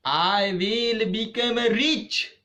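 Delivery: high, slow, resolute vow; determined. Acted. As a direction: declare it like a bold promise. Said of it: "And she said".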